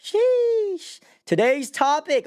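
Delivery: high, loud sheesh; excited. Real, not acted. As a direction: hype up the topic. Hype exclamation.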